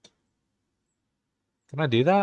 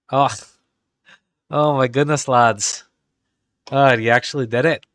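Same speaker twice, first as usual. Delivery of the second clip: surprised; 8 dB louder than usual. Real, not acted. Stunned when it works.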